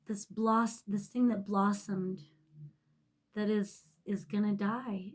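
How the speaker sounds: ordinary talk, nothing marked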